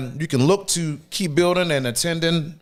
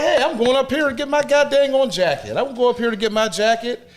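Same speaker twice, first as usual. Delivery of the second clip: raised high, an urgent mock call; retold call-out; 8 semitones higher than usual. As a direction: call out, demanding the jacket back. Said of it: "So I'm like".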